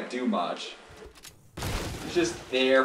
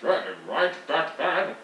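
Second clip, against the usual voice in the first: hammed-up old game character voice, slow and drawn out; Deckard Cain; 4 semitones higher, slower than usual. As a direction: put on an exaggerated raised character voice. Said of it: "bust out the Deckard Cain voice".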